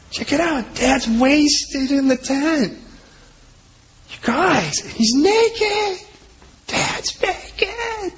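high, giddy voice of a son gossiping; gleeful Ham. Retold or imitated. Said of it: "this guy Ham kind of looks and he goes".

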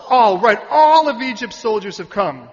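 "All" boomed out very loud and stretched; loud, drawn-out punch on "all"; emphatic ALL. Real, not acted. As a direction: hit "all" hard, high and long. Echoing the crowd's answer emphatically.

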